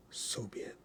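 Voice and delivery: whispered amen. Acted. Teacher seals the prayer, whispered. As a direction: breathe it out as a whisper.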